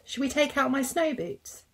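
put-on high, eager little-girl voice; high child voice full of hope; little Seren. A narrator's reading. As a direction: ask it like a hopeful small child. Child character Seren asks.

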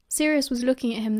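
ordinary delivery, nothing marked

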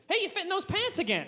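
cheerful and oblivious; clumsy compliment. Pretend. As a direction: boyfriend blurting the wrong compliment. Botched compliment to girlfriend.

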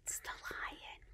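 whispered, very soft announcement; hushed reveal. Pretend. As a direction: whisper the animal's name to little ones. Reveals the next animal to toddlers.

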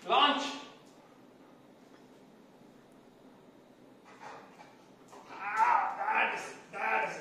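loud happy exclaiming; excited praise. Real, not acted. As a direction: cheer the jump with delight. Dog jumps, owner praises.

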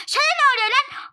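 high, loud confrontational shout; angry shout. Acted. Mother shouts at the other parent, angry. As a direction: get in their face. Quarrel escalates.

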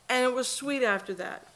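ordinary talk, nothing marked

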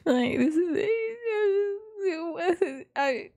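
choked, almost crying from amusement; sounds like crying. Real, not acted. Viewer overwhelmed by so much happening, sounding like crying. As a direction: struggle to get words out, near tears with laughter. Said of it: "sounds like I'm crying".

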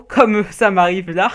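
loud, amused laugh through the words; slow words breaking into real laughter. Real, not acted. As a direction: laugh at your own fumble. Laughs at losing his thread.

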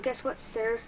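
ordinary talk

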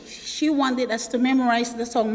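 ordinary delivery

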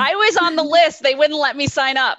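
loud, high-pitched playful complaint; jokey protest. Real, not acted. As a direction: protest it loudly over the laughter. Joking protest about sign-up.

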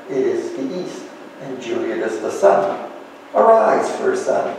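Shakespeare recited in a lecture; poem recitation. Shown as a demonstration. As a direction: read it as verse, lifting on Arise. Continues Romeo's lines.

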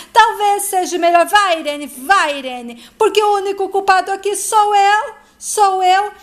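exasperated father at the end of his rope; angry outburst. A narrator's reading. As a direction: burst out in bitter self-blame. Father explodes in family quarrel.

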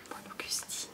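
breathy whispered repeat of a name; whispered echo. Retold or imitated. Repeating the nickname Philippe gives her, whispered. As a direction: whisper it tenderly to yourself. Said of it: "j'ai entendu".

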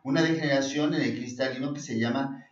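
ordinary delivery

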